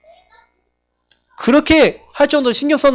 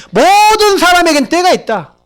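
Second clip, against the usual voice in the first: higher, slow proclamation as God; solemn divine pronouncement, higher and slow; God's answer; 8 semitones higher, 3 dB louder, slower than usual. Acted. Preacher voices God's answer.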